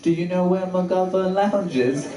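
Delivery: slow, badly faked British accent. Asking a lady on campus for directions, in a fake British accent. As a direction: enquire politely in drawn-out mock British. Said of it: "gonna use a British accent".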